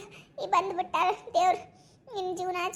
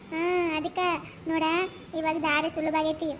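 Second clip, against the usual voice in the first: ordinary delivery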